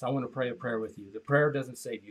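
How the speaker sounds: ordinary delivery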